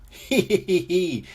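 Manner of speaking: high "hee hee" laugh; giggling. A narrator's reading. Everybody laughs at the happy ending, high giggle. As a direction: giggle in quick high hees. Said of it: "Everybody laughs".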